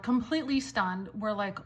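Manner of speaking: ordinary talk, nothing marked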